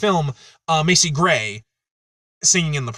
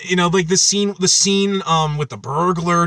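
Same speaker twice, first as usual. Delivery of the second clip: ordinary talk, nothing marked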